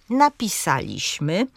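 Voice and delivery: napisaliśmy as na-pi-SA-liś-my; antepenultimate stress. Shown as a demonstration. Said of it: "Na trzecią sylabę od końca akcent pada".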